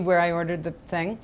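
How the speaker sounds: ordinary talk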